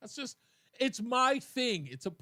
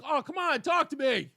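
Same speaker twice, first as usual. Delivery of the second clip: high, louder wheedling plea; mock pleading; 6 semitones higher, 5 dB louder than usual. Husband to silent wife, exaggerated pleading. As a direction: coax them loudly to talk. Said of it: "then I'm like".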